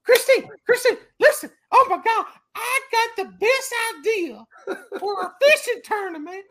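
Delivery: high, loud, breathless mock excitement; hammed-up overexcited husband, nearly squealing; a husband gushing to his wife in labor, squealing with excitement; giddy excitement. Mocking friend's call during wife's labor.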